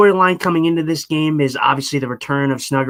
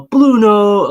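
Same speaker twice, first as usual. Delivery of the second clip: imitated catchphrase call; 6 semitones higher, 6 dB louder than usual. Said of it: "when you do the".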